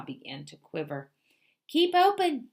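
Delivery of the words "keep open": a loud, high yell; the dentist yells loud and high at the fox to keep his jaws open. A narrator's reading. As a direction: bark the order up at the fox. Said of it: "yelled Doctor DeSoto".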